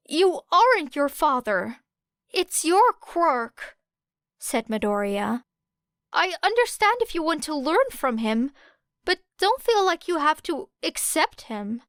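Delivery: put-on a boy urging his friend gently; lightly voiced earnest plea. A narrator's reading. Midoriya pleading.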